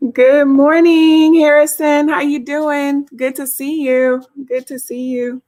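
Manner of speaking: high, slow, beaming hello; bubbly greeting. Real, not acted. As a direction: sing out a cheerful welcome. Greeting a viewer on stream.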